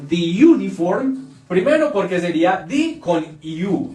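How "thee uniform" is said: "the uniform" as "dhee yoo-niform"; wrong thee before uniform. Shown as a demonstration. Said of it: "Aquí yo no diría".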